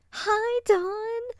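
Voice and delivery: very high, loud squealed greeting; excited vixen. Acted. Arctic vixen greeting her friend at the door, excited. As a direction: squeal hello, bursting with excitement. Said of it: "the excited Arctic vixen said".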